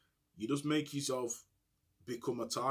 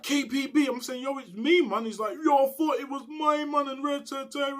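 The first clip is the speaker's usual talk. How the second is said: much higher, louder mock caller; excited recognition; 12 semitones higher, 5 dB louder than usual. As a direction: pitch it way up like a hyped caller on the phone. Caller recognises him on phone.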